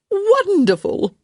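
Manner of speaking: very high, loud, drawn-out delight; delighted exclamation. A narrator's reading. Hostess hearing he'll meet her daughter, delighted exclamation. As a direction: burst out in high-pitched joy. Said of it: "Lady Plainsworth exclaimed".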